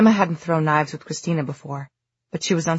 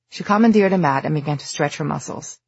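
usual speaking voice

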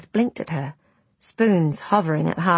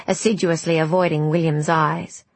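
ordinary delivery

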